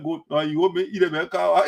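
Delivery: ordinary talk, nothing marked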